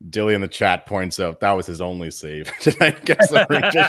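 breaking into real laughter while reading out a joke; laughing while talking. Real, not acted. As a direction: let the laugh take over the line. Laughs while relaying chat joke.

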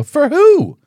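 very high, loud comic squawk of disbelief; indignant shout. Retold or imitated. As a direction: burst out in exaggerated outrage. Retold indignant retort to friend.

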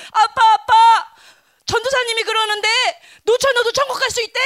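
laughing imitation of a child; child's voice. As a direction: play an eager kid telling dad the news. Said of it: "이렇게 말한 거야, 아동부회가".

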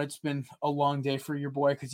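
ordinary delivery

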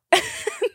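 very high squeaky giggle; high squeal laugh. Real, not acted. Laughing at how scared she got, squealing.

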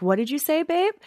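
lilting question, rising high at the end; retold sweet parental query; imitating innocent mother. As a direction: ask a child lightly, pitch climbing. Mother feigning innocence to her child.

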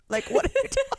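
high, laughing exasperation; laughing disbelief. Retold or imitated. Friend holding three keys, laughing high in disbelief.

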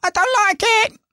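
very high, sulky "I don't like it" with a rising end; mock whiny kid. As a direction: squeak "I don't like it" like a pouting child. Mocking whiny voice again.

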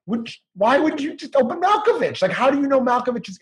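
high-pitched, baffled rant; incredulous. Real, not acted. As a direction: puzzle aloud over a plot hole, voice climbing high. Ranting about a film plot hole.

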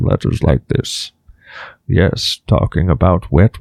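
put-on low, slow old sage voice; Deckard Cain voice. Shown as a demonstration. Host shows the guest his Deckard Cain voice, low and slow. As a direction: drop into a slow aged storyteller. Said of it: "I talk like this the entire time".

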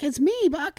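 squeaky high cracking falsetto; imitated puberty voice. Telling mom on the phone who is calling, cracking high. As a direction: mimic a voice breaking way up high. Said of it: "your voice starts to sound like this".

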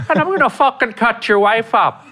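high leprechaun turning menacing; sweet leprechaun voice gone threatening; Lucky leprechaun voice. Retold or imitated. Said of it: "Think Lucky".

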